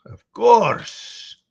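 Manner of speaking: loud, high eager reply; character voice. Acted. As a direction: blurt it out in character.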